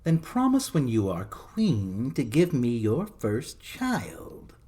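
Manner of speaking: slow, sly imp demanding a price; slow, greedy creature voice; little man voice. A narrator's reading. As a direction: draw out the demand like a scheming gnome. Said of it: "said the little man".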